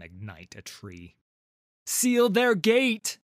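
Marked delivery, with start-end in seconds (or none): 1.9-3.2 loud, high, drawn-out battle order; shouted command. A narrator's reading. Warrior Pantheon ordering the dragon to the crevasse, shouted command. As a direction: bellow the order, stretching each word. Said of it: "she commands".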